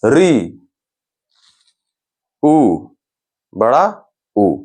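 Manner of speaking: slow, spaced vowel sounds for the class; vowel demo. Shown as a demonstration. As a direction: sound out each vowel clearly. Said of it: "इनको उच्चारण करने में हमारा जो मुख है".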